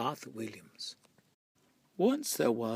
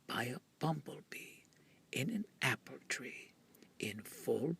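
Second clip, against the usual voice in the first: whispering; 8 dB quieter than usual. A narrator's reading. Tiniest sound, hushed.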